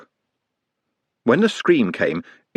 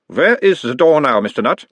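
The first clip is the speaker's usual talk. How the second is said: put-on higher, louder doctor's voice with a light Uberwald accent; mild Uberwald accent; 5 semitones higher, 4 dB louder than usual. A narrator's reading. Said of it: "mild Uberwaldian accent".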